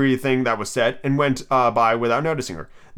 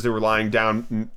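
usual speaking voice

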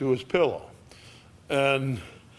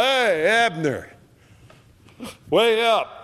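calling out across the hill, higher and slow; hollering up high; 7 semitones higher than usual. Retold or imitated. David on the hilltop calling down to Abner, hollering. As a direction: hail him from far off, drawn out. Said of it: "he hollers back down".

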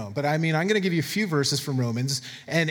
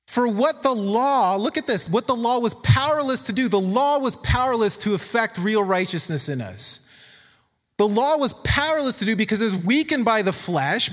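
emphatic scripture reading; 5 semitones higher than usual. Acted. Preacher reads Romans to the church, emphatic scripture reading. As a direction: read the verse out with raised, deliberate weight.

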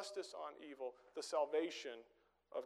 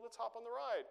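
higher, eager kid voice, lightly imitated; little son; 8 semitones higher than usual. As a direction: chirp it like an excited six-year-old. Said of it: "he's like".

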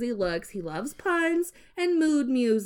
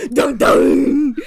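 loud dramatic sting; dramatic dun dun; 3 semitones higher, 11 dB louder than usual. Acted. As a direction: belt it like a drumroll.

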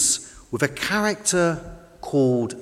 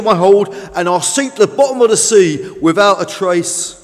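put-on loud, higher-pitched old boat, full of woe; grumbling old fishing boat; 7 semitones higher, 9 dB louder than usual. Old Fish frets to Tug about sinking, grumbling. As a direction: complain loudly like an overloaded old boat.

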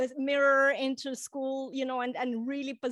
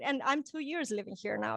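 ordinary talk

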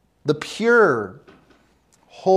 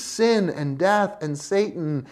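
ordinary talk

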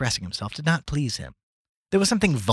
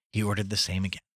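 ordinary delivery, nothing marked

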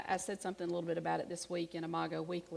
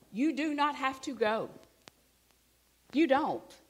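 higher and louder, insisting; emphatic; 6 semitones higher, 5 dB louder than usual. Real, not acted. Preacher insisting to the congregation they need not go. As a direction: press the point home firmly.

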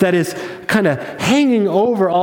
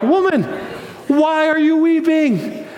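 much higher, elated disbelief; excited angels; 8 semitones higher than usual. Acted. Excited angels ask weeping Mary at the tomb, thrilled. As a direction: exclaim the question high, bursting with good news. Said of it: "they're excited. So naturally".

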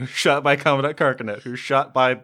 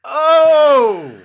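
high, loud startled "Oh!"; shocked gasp; 12 semitones higher, 9 dB louder than usual. Real, not acted. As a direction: yelp in shock at the roll. Reacting to snake eyes.

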